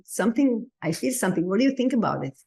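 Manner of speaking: ordinary talk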